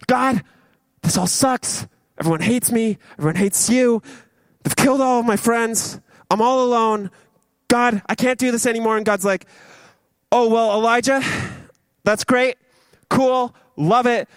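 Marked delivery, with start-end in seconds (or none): 0.0-9.0 high, acted whiny complaint; mock self-pity, pitched up; pouring out his heart. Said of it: "just pours out his heart". 10.3-14.3 high, casual acted brush-off; mock-chipper, with pauses between replies; breezy dismissal. God voiced breezily dismissing the complaint.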